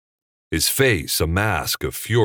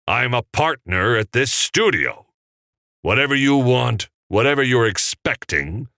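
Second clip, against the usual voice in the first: firm, pitched-up power play; domineering, emphatic declaration; aggressive assertion; 5 semitones higher, 4 dB louder than usual. A narrator's reading. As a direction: lay down the law forcefully. Seemed to project aggression.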